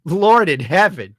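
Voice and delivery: exasperated, stretched-out appeal to heaven. Real, not acted. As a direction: cry it out slowly in dismay. Repeats his dismayed exclamation louder.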